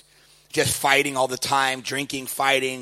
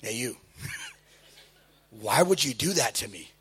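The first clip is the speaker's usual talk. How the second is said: quiet complaint; 5 semitones lower, 8 dB quieter than usual. Retold or imitated. Confronting the guy.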